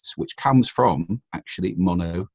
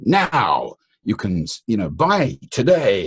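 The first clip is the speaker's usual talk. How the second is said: "Now you can, you know, buy today" slow, louder, falling firmly on "buy"; down talk; 3 semitones higher, 4 dB louder, slower than usual. Shown as a demonstration. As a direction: land each phrase down with authority. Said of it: "the kind of down talk".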